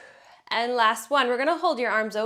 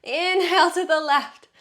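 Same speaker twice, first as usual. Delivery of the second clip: loud; 6 semitones higher, 5 dB louder than usual. Shown as a demonstration. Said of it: "You're probably sick of hearing me say exhale".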